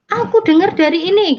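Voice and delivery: high-pitched, pressing retort; acted-out scolding sibling, voice pushed up high; accusing. Sibling confronts convert with what was heard, accusing.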